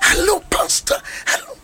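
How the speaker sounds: high breathy hello pastor; overexcited imitation; excited greeting. Lady at the airport greeting pastor, excited. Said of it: "Why excited?".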